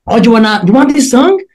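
high-pitched mock offer, fed up. DJ answering a girl who kept requesting a song, fed-up retelling. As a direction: act out needling a pushy requester. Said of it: "just had enough of it".

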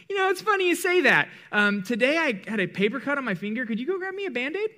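high, sing-song faux-polite ask; cheeky high mock-innocent request. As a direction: play it innocent while taking advantage.

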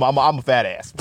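ordinary talk, nothing marked

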